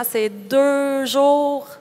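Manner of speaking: "deux jours" drawn out very slowly; emphatic stretch. Real, not acted. Trainee explains how demanding the course is, emphatic.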